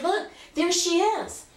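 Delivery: high, drawn-out excited pointing-out; excited child. Retold or imitated. Child showing her mother the attic window, excited. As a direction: show her off in a high little-girl voice. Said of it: "I was so excited".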